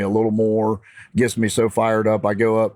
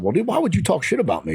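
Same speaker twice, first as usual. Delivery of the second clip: higher, confronting voice, a bit heated; heated challenge; 8 semitones higher, faster than usual. Retold or imitated. To the rival who talked trash, heated challenge. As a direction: demand an answer with some heat. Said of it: "I'm heated with him a little bit".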